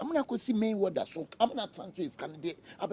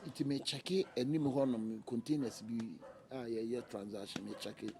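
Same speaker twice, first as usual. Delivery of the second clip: low, soft, slow explaining; soft pleading; 10 semitones lower, 8 dB quieter than usual. Acted. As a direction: plead gently.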